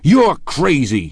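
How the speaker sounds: loud, high outburst with drawn-out words; explosive anger. A narrator's reading. Tom answers his rival's claim on his wife, exploding. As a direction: explode in outraged disbelief. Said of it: "he exploded".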